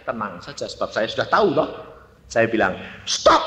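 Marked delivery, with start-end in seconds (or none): 3.1-3.4 high, abrupt shout; retold yell. Eldest child silencing crying siblings, retold yell. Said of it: "Saya teriak".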